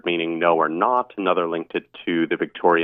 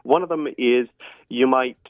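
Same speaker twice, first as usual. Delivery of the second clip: ordinary talk, nothing marked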